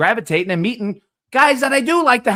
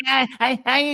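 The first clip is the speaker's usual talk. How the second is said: mocking drunk excuse; 7 semitones higher, 6 dB louder than usual. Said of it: "when someone has to count".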